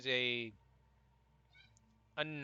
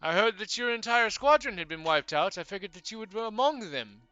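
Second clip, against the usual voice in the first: much higher and louder, a fussy polite bird-man; high-pitched owlfolk stranger; 10 semitones higher, 14 dB louder than usual. Acted. Bird-man begging the party for help, high and fussy. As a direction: chirp it out, flustered but courteous. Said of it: "he turns and looks and goes".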